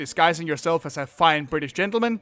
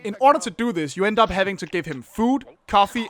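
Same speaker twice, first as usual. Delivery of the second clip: ordinary talk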